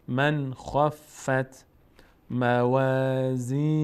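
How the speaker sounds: "من خفت موازینه" with a crisp n and no stop, slow; clear nun, no pause. Shown as a demonstration. Teacher modelling izhar before kha, slow and clear. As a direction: glide past the nun of "من" into "خفت".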